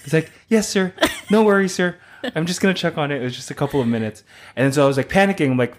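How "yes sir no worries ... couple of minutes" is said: much higher, sugary customer-service voice; overly polite server. Retold or imitated. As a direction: sweet-talk the angry customer. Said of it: "You know, I didn't say it like that".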